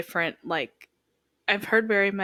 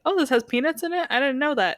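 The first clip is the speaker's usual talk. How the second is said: light, higher-pitched "oh, really?"; casual mild surprise; 6 semitones higher than usual. Acted. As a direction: breezily act out not having known. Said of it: "'Cause then you get to be like".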